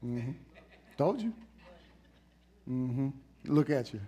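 mildly scolding; mock a smug scolding look; a hint of a smug judge; smug told-you-so. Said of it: "he wasn't looking at him like".

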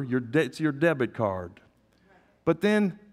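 ordinary delivery

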